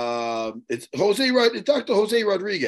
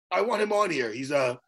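ordinary talk

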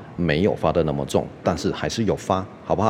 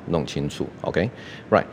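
ordinary delivery

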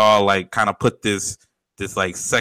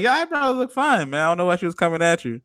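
high mock reassurance; 8 semitones higher than usual. Friend's imagined reassurance.

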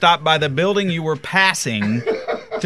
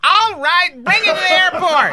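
high, hollered mock whine; John Boy; 12 semitones higher than usual. Imitating John Boy giving in on the airport, whiny and hollery. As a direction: mimic a grudging demand, pitched way up. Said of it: "It's whiny and hollery".

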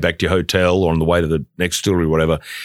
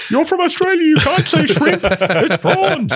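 very high-pitched caricature of a scolding mother; mock-scold in a squeaky falsetto; hammed-up falsetto mum, indignantly correcting; exaggerated mother impression; slower than usual. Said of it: "So she doesn't talk like that".

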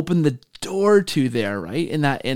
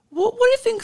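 much higher, lightly mocked pastor question; quoting the pastor; faster than usual. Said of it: "I can't do his accent".

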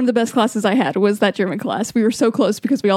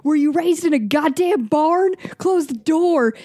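higher-pitched scolding, no real shout; mock dad yell; 6 semitones higher, slower than usual. Dad to kids who left the door open, mock yell. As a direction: yell it like a fed-up dad, at half volume. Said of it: "I'm gonna yell out".

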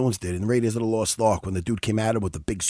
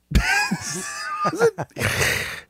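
sudden high burst of laughter; 3 dB quieter than usual. Real, not acted.

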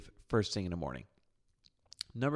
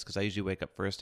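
usual speaking voice

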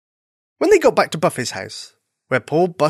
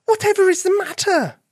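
very high-pitched, worried question; concerned cry. A narrator's reading. Friend asking the crying bunny what's wrong, concerned. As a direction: exclaim it high, full of concern. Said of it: "cried a voice".

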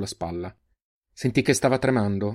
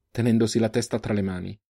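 ordinary delivery

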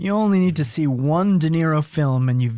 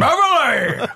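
Pacino yell; 6 semitones higher, slower than usual. Retold or imitated. Pacino voice yelling at wife.

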